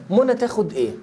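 usual speaking voice

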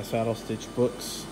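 normal talking voice

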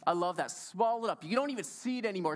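ordinary talk, nothing marked

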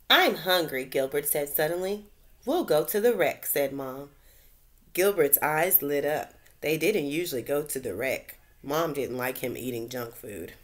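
bright, lively read-aloud voice; reading to children. A narrator's reading. Teacher reads a story to her class, lively storytelling. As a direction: tell it like a bedtime storybook.

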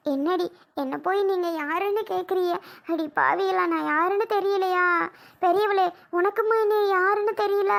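high-pitched affronted scolding; indignant grandmother. Acted. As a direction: demand answers, hurt and incredulous. Grandmother berates grandchildren for forgetting her.

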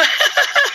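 squealing high laugh. Real, not acted. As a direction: break into a shrieking laugh. Laughs at own reaction.

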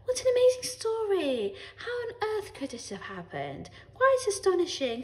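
high-pitched put-on wonder; acted amazement. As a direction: keep up the show of astonishment. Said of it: "They're good actors".

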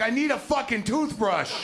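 frantic guest shouting for a toothbrush; desperate shout. Retold or imitated. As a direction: bark the demand down the phone. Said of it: "I'm like".

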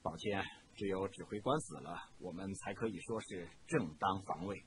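quiet, low and cold. A narrator's reading. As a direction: say it softly, without feeling. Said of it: "低声地说".

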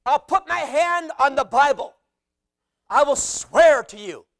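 impassioned oath-taking, punched out loud; emphatic oath. Acted. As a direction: swear it forcefully to them. Acting Paul swearing an oath.

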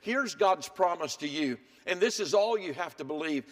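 ordinary delivery

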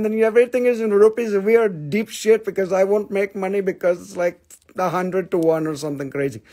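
put-on Indian accent. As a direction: rattle it off in a mock Indian accent. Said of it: "when I talk Indian".

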